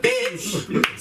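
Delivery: very high, squealing comic shout; mock outraged yell. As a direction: explode at mom in indignation. Angry outburst at mom.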